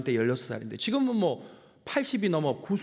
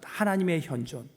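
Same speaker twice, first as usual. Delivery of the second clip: ordinary talk, nothing marked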